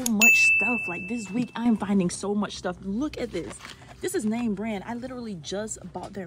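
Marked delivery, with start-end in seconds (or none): none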